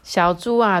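usual speaking voice

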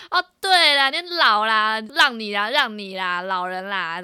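sneering shout telling the elder to have their way; retold mocking shout. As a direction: taunt the old man loudly. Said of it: "我就跟他说".

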